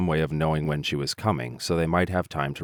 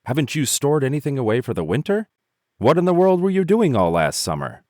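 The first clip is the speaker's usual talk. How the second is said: high, louder incredulous ants; scolding ants; 11 semitones higher, 7 dB louder than usual. A narrator's reading. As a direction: scold the lazy grasshopper. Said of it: "cried the ants in surprise".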